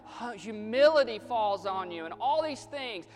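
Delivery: high, loud, driving sermon delivery; full-force preaching. Acted. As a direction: hammer each repeated phrase at the congregation.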